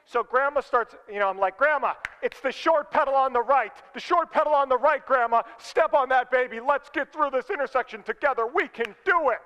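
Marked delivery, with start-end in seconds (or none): 1.6-9.4 higher, loud exasperated coaching; mock impatient yelling. Acted yelling at slow driver.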